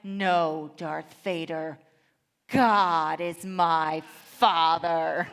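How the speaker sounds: loud, drawn-out dramatic retort; defiant young son. Retold or imitated. Son defies his Vader father in play, loud slow declaration. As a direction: declare it slowly and grandly, word by word. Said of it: "my son looks at him and replies".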